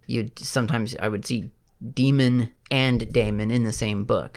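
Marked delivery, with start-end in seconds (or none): 1.9-2.5 Demon with a long ee; first syllable of Demon like "dee"; DEE-mun pronunciation. Shown as a demonstration. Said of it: "have to pronounce it differently". 3.1-3.5 Daemon with a long ay; first syllable of Daemon like "day"; DAY-mun pronunciation. Shown as a demonstration. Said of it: "have to pronounce it differently".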